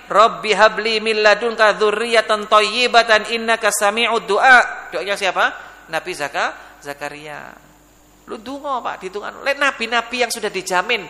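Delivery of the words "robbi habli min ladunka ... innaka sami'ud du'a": loud, measured Arabic recital; prayer recitation. Acted. Preacher quotes another prophet's prayer for offspring, loud measured recitation. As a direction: recite it loudly, drawing out the ending.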